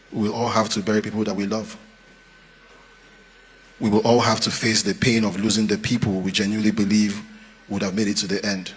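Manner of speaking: ordinary delivery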